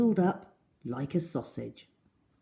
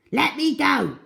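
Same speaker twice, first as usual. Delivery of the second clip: higher, louder desperate cry; yelling; 8 semitones higher, 4 dB louder than usual. A narrator's reading. Crocodile begging Trunky, yelling. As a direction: plead loudly while swung. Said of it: "yelled the enormous crocodile".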